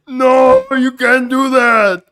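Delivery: high, loud, slow mock shout; hammed-up, drawn-out outraged yell; Arnold. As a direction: stretch each word in a bellowed imitation. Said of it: "He sounded like Arnold".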